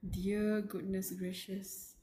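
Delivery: ordinary talk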